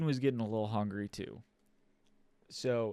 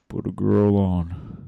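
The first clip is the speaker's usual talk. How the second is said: low gruff demand; 5 semitones lower, 7 dB louder, slower than usual. Retold or imitated. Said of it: "I just go".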